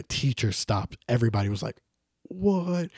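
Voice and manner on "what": high-pitched, drawn-out mock shock; retold disbelief. Everybody watching the punch, stunned mock disbelief. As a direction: react as a stunned onlooker.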